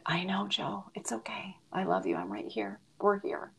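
soft, broken whisper at the bedside; comforting a dying friend in a whisper; tearful whisper. Real, not acted. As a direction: hush it, comforting through tears. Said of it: "I'm gonna get so, so emotional".

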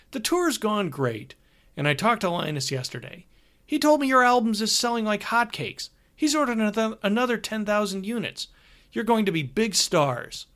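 brash, cheerful tour manager; hearty road manager. Acted. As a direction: play the chummy older road manager. Hogarth voice again.

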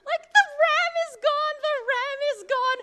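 screaming high and loud with a laughing cry; panicked yell. Retold or imitated. Daughter screaming at dad to stop the car, panicked. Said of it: "I immediately yell".

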